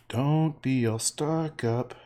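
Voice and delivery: slow, stretched singsong; drawn-out. Pretend. Painter repeating own advice to the stuck-up, drawn-out. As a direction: stretch every word out, half sung.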